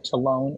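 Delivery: "talon" as tuh-LOHN, rhyming with alone; long-o variant. Shown as a demonstration. Card teacher showing another way people say it, "talon" as tuh-LOHN. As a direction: say "talon" with a long o in a stressed second syllable. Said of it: "You will hear it".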